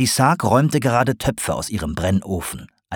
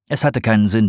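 ordinary delivery

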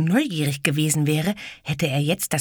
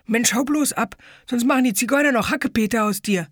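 put-on high, louder jeering kid's voice; mocking bully; 9 semitones higher, 4 dB louder than usual. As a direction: jeer at the boy to scare him off. Fat boy mocking taunt.

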